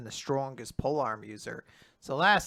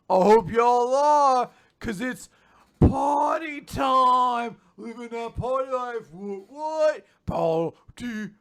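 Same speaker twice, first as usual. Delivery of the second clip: party bro; 7 semitones higher than usual. Retold or imitated. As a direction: hype up the room like a rowdy frat partier. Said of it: "a fucking party bro".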